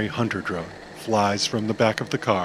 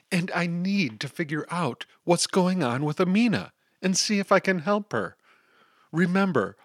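lightly voiced girl, higher and earnest; pleading to get home, a touch higher; Dagny's voice; 7 semitones higher than usual.

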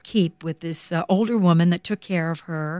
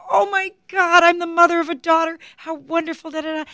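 high, delighted shout of joy; ecstatic cry; 10 semitones higher than usual. Retold or imitated. Shirley gushing over the new adopted daughter, high and thrilled. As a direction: burst out with delight. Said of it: "She said".

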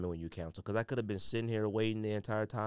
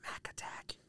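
whispered under the breath; whispered aside; 11 dB quieter than usual. Real, not acted. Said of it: "Nike Attack S P".

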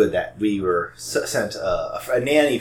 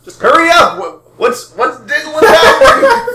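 excited; 12 dB louder, slower than usual. Acted. Character panicking in the dungeon.

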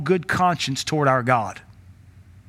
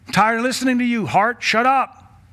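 fed-up shout at own heart; 7 semitones higher than usual. Pretend. Preacher telling his heart to shut up, fast fed-up yell. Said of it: "do the same to you".

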